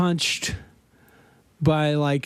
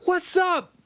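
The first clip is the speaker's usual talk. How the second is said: cocky taunt; 10 semitones higher, slower than usual. Retold or imitated. Swimmers taunting fleeing gang.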